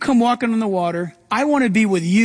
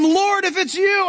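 eager Peter; 10 semitones higher than usual. Retold or imitated. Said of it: "Peter answered and said".

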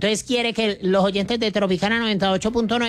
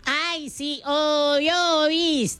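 silly affected voice; 9 semitones higher, much slower than usual. Pretend. Co-host answers the presenter's check-in, silly affected voice. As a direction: play up a put-on, high-pitched agreement. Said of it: "no hablé así, hablé normal".

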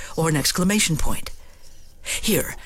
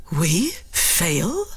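shocked. Shown as a demonstration.

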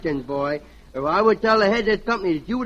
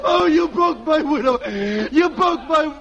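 hammed-up kid's wail, higher; boy wailing after his mother hits him; mock wailing; 4 semitones higher than usual. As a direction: howl in mock pain after the slap. Kid struck by mother.

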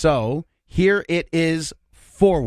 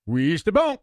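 backwards speech; 3 semitones higher than usual. Shown as a demonstration. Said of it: "Now reverse".

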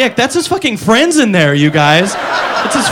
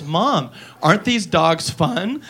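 slow, softer sing-song question; ad girl; 5 semitones lower, 8 dB quieter, slower than usual. Acted. Comic mimicking the dubbed ad girl, lightly sweet. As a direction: drag it out like a kid in a commercial. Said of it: "now she goes".

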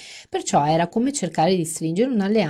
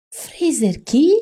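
1 incredulous; 9 semitones higher, 7 dB louder, much slower than usual. Acted. Fran's incredulous question.

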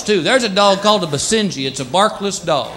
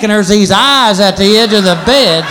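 normal talking voice

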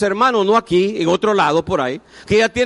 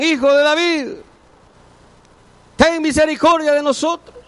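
higher, pleading mock cry to Jesus; crying out for mercy; 9 semitones higher, 4 dB louder than usual. Blind men calling out to Jesus, raised pleading cry. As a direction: call out like the blind men begging. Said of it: "le gritaban".

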